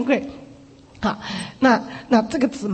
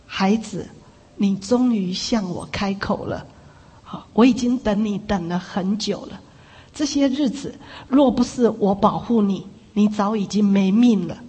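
lower, measured voice of one long waiting; Jesus; 3 semitones lower than usual. Retold or imitated. Jesus answers the sister in her ear, low fatherly voice. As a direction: speak as a patient divine voice, pitched down. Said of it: "耶稣在对他说话".

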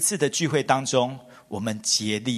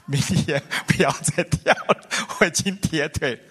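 put-on tired old father. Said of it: "然后到晚上又说".

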